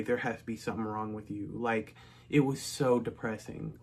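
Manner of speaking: ordinary delivery, nothing marked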